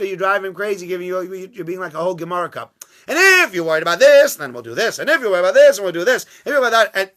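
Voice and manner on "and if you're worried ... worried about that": high, loud, insistent questioning; mock badgering. Rabbi hammering the sick man with worries, loud and insistent. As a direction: pile the worries on him, rising each time.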